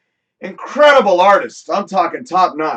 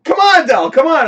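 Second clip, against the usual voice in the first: high, loud cheerful coaxing; upbeat urging, much higher and louder; coaxing friend; 10 semitones higher, 6 dB louder than usual. Retold or imitated. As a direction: pull a reluctant friend onto the dance floor. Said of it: "she's like".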